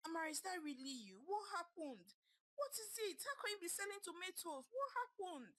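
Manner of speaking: high, fast disbelieving questions; old roommate finding her friend selling tomatoes, shocked. A narrator's reading. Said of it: "Chika asked in shock".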